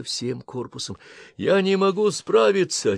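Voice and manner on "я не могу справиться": high, panicked cry; panicked shout. Acted. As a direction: lose control in your voice. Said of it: "кричал пилот".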